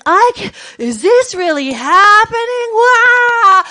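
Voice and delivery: high, loud panicked disbelief ending in a yelp; freaking out. Retold or imitated. Tripping teacher panicking to her friend, loud high wail. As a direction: spiral up into a wail.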